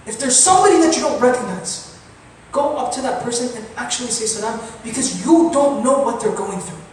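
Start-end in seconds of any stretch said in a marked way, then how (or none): none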